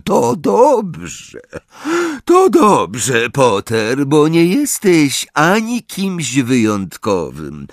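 scornful teacher, high and slow; Snape. Acted. Teacher tells pupil he is nobody special, slow cutting scorn. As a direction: put the pupil in his place, drawing out each phrase. Voices Snape putting Harry down.

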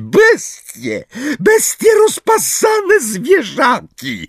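high, squeaky shriek; Umbridge. A narrator's reading. Umbridge abuses the centaurs, shrieking. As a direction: scream abuse, stretching the words. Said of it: "krzyknęła piskliwym głosem".

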